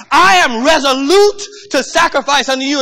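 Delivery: loud, fervent preaching build. Acted. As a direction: preach it with rising fire. Preaching at full force.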